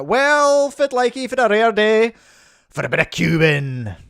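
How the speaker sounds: put-on high, loud Scottish accent. Host voicing Scottish Mike's reply, broad Scottish accent. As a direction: play a cheery Scottish cuber in a broad Scottish accent. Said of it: "asking even more Scottish Mike".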